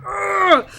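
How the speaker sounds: high, loud fangirl cry; excited squeal. Retold or imitated. Fan reacting to the chapter, loud excited cry. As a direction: shriek with excitement. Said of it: "I'm like".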